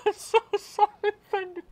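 very high, squealing wail; laughing and crying. Real, not acted. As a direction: wail it through tearful laughter. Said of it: "I'm laughing, but I'm crying".

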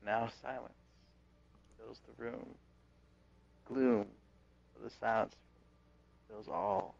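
mock ominous narration. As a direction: narrate the gloom like a mock horror trailer.

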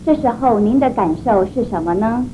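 ordinary talk

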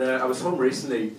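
ordinary talk, nothing marked

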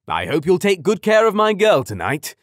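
loud, high fatherly request; protective dad voice. A narrator's reading. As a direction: gently warn the date. Father addresses daughter's date.

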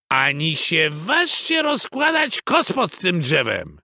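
high, scolding beaver mother; indignant call. A narrator's reading. Mrs Beaver forbidding the blanket, calling out. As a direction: cry out a warning. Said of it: "zawołała pani Bobrowa".

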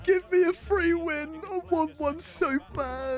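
high-pitched drawn-out wail; desperate wailing. Real, not acted. Gamer begging the game for a free win, wailing. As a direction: wail it out, pleading.

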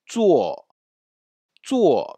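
"Zuo" said high with a rising-then-falling tone, ou gliding into a strong o; zuo demonstrated. Shown as a demonstration. Teacher models "Zuo" for the class, sounding it out clearly. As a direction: sound out "zuo" slowly, letting the o carry the vowel. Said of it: "Il se prononce ou après le Z".

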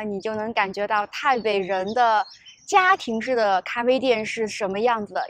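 ordinary talk, nothing marked

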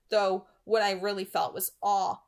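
ordinary delivery

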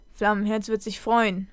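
plain reading voice

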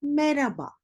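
merhaba as me-ra-ba, falling; dropped H. Shown as a demonstration. Teacher repeats the greeting, merhaba without H. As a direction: say merhaba with silent H. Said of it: "H sesi sanki yokmuş gibi".

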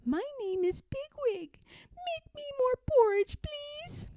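put-on high little girl-pig voice; little piggy voice. Pigwig asks Pigling for porridge, high and small. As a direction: chirp it up small and sweet. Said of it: "a little voice spoke".

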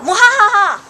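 loud high evil cackle; sneering panto villain. Acted. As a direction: throw back a gloating villain laugh. Queen gloats as Troy burns.

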